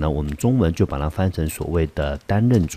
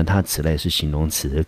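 normal talking voice

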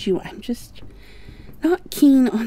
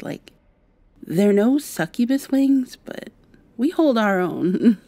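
ordinary talk

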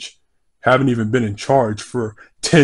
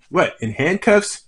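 ordinary talk

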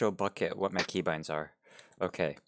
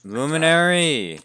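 calling out; 6 semitones higher, 7 dB louder than usual. Real, not acted. Calling a teammate over.

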